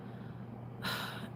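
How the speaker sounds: long breathy sigh. Real, not acted. Loud breath between remarks.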